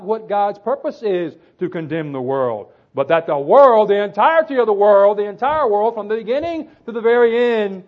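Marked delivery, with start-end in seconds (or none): 2.9-7.8 loud, ringing proclamation; emphatic preaching. Real, not acted. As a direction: drive it out big, piling up each phrase. Preacher building on scripture's scope.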